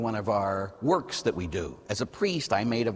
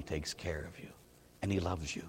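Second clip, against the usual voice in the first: whispered, unvoiced and soft; 7 semitones lower, 10 dB quieter than usual. Real, not acted. Priest telling the congregation God loves them, whispered. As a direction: breathe it like a secret comfort. Tender close about the Father.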